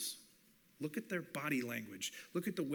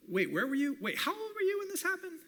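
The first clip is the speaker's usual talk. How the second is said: eager, much higher questions; curious questions; 12 semitones higher than usual. Pretend. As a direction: ask as if hanging on every word. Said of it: "you ask clarifying questions".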